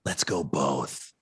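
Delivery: low and slow, a mock studio exec; deep mock producer. Producer deciding to use both cheesy lines, low and slow. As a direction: drop the voice to play the producer. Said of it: "And they're like".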